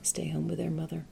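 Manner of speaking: hushed, playfully wounded complaint; mock self-pity. Mother jokes that college-bound children abandoned her, mock self-pity. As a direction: play the abandoned mother, trailing softly. Mock lament, then admits she's thrilled.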